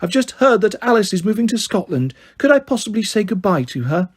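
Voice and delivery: high, snobby posh English; polite, la-di-da posh girl; posh put-on voice. A narrator's reading. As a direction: request it in a put-on posh accent. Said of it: "snobbiest, poshest voice ever".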